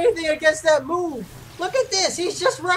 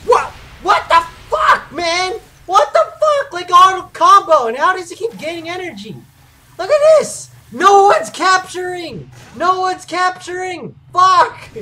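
heated, raised-voice gamer rant; angry ranting; 3 semitones higher, 5 dB louder than usual. Real, not acted. Gamer raging that no one's capturing, angry ranting. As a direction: rant in real exasperation, hitting the curses loud.

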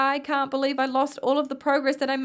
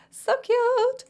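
high, louder squeal of "So cute"; 12 semitones higher, 4 dB louder than usual. Real, not acted. Squealing aww at baby.

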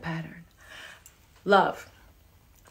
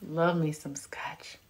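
hushed whisper, slow; 4 semitones lower, 9 dB quieter, slower than usual. Real, not acted. Whispering her love of scotch to viewers. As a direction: breathe it like a secret.

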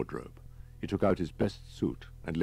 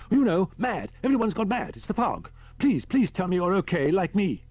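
high, loud, desperate babble; frantic panic; 13 semitones higher, 6 dB louder than usual. A narrator's reading. Frightened man begging Holman to be sane, frantic and high. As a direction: plead in a high-pitched panic.

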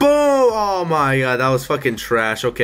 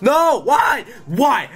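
frustrated shout; 9 semitones higher, much slower than usual. Real, not acted. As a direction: yell at the game. Another bad run.

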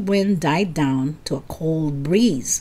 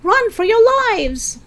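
much higher and louder frightened scream; scream for your lives; 14 semitones higher, 5 dB louder than usual. A narrator's reading. Eddie telling friends to flee the wings, panicked scream. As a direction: shriek the warning in panic. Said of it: "Eddie screamed".

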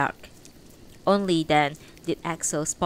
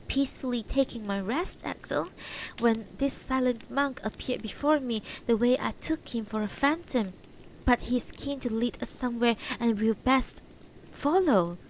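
a hint of gentle elderly wife; soft, higher old woman's voice; lightly voiced old woman; 6 semitones higher, 3 dB quieter than usual. Beatrice voiced in story.

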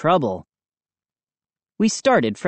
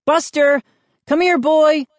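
high-pitched call to a dog; 12 semitones higher, 4 dB louder than usual. A narrator's reading. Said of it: "I called".

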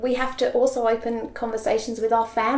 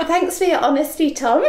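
high, amused retort through laughter; laughing mock gratitude; 6 semitones higher, 4 dB louder than usual. Mum answering her son's frank verdict, laughing mock gratitude. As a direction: say it laughing, as a teasing thanks. Mum's retold reply to son, laughing.

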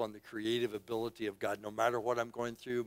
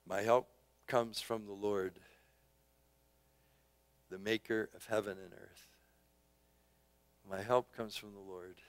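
quiet reverent reading; 5 semitones lower, 8 dB quieter than usual. Acted. Quiet reflective scripture answer.

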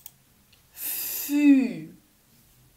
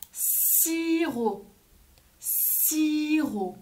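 long s and drawn-out i, higher pitch; stretched-out word; 5 semitones higher, much slower than usual. Shown as a demonstration. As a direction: draw the word out for young learners. Teacher models word for children.

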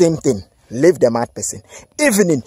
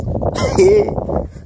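high breathy laugh; 14 semitones higher than usual. Real, not acted. Laughs at doubter.

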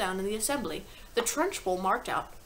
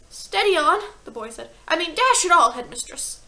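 put-on high, loud boy protesting; 8 semitones higher, 10 dB louder than usual. A narrator's reading.

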